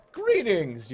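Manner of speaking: put-on a bright, loud hello to an old friend; enthusiastic greeting. A narrator's reading. Player greeting the assassin with an eager hello. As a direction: greet him like you're glad. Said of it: "you declare enthusiastically".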